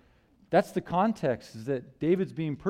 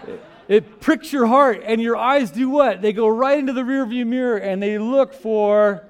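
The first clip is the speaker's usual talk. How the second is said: animated preaching; 6 semitones higher, 8 dB louder than usual. Acted. Preacher builds the red light story.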